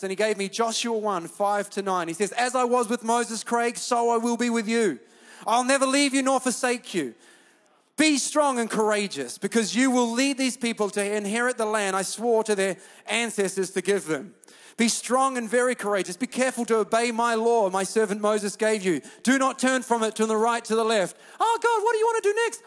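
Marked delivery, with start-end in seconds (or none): 2.3-21.2 loud, commanding preacher's recitation; declaimed scripture. Acted. Pastor reciting God's charge to Joshua, forceful declamation. 21.4-22.6 high, rushed squeaky voice; mock eager believer. Believer asking God what comes next, squeaky mock eagerness.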